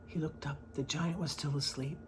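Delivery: plain reading voice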